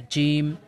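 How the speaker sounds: জিম with a firm, hard j; strong, firm জিম; hard jim. Shown as a demonstration. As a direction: press the j of জিম hard and clear. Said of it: "এটা শক্ত".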